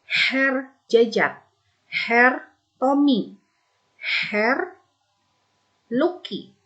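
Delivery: slow, drawn-out "Herr" before each name; teacher giving slow, clear examples of Herr with names. Shown as a demonstration. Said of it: "digunakan untuk laki-laki".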